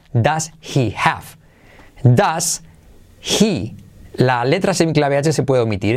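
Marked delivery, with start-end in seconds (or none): 0.1-3.8 slow demo. Shown as a demonstration. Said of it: "Pero mírate".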